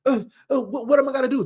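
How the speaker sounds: normal talking voice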